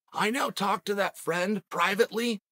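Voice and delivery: ordinary delivery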